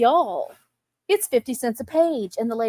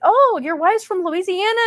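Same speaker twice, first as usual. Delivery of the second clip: higher, lightly surprised question; mock surprise; 9 semitones higher, 4 dB louder than usual. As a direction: ask it with mild disbelief. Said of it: "and they said".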